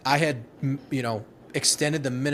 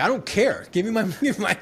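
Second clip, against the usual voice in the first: high-pitched, exasperated retold retort, amused; bitter Marine veteran; 8 semitones higher than usual. Departing Marine retelling his paperwork retort, hammed-up bitterness. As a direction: play up the fed-up veteran, pitch jumping up. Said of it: "that bitter Marine veteran moment".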